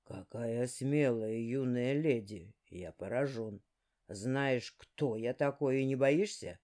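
low, slow sly villain; a deep, unhurried demon lord; dark lord voice. Acted. Dark speaking.

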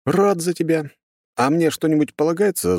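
high-pitched, needling hero voice; cheeky hero. Acted. Hero asks god for his share, cheeky.

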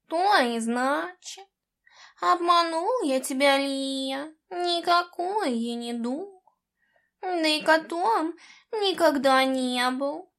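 mild rueful confession; confessing. A narrator's reading. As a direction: admit it with regret. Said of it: "признался он".

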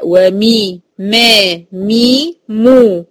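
"ومي، ما، مي، مو" each drawn as a pure vowel; distinct vowels. Shown as a demonstration. Teacher drills the meem, "مي، ما، مو" clearly held. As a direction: slowly separate "مي", "ما", "مو" with full vowels. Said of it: "أتم كسر الميم".

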